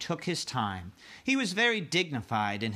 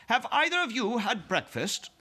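queen voice; 10 semitones higher than usual. Acted. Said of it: "the Queen said".

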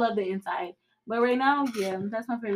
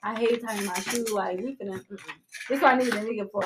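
normal talking voice

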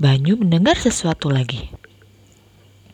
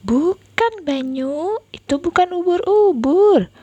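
big sister voice; 10 semitones higher than usual. Acted. Sister correcting scared brother.

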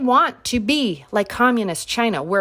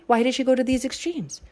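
usual speaking voice

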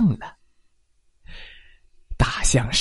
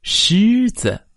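ordinary delivery, nothing marked

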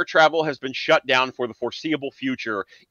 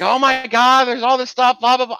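high, loud excited mother; high mom voice; 12 semitones higher, 6 dB louder than usual. Retold or imitated. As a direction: gush like a chatty mom. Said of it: "She's like".

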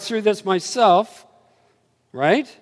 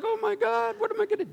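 panicked Finney; 4 dB quieter, faster than usual. Retold or imitated. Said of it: "It's like".

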